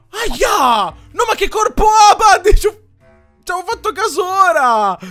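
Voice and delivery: high, loud startled shout. Real, not acted. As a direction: yelp in shock. Startled by character's body.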